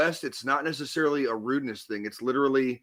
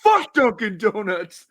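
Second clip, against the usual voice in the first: slow, louder mock yell of a drunk fan; mock fan rant; 5 semitones higher, 7 dB louder, slower than usual. Laughing at a fan's video, a slow mock rant. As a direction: ham up a fan cursing a rival's coffee. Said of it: "He's like".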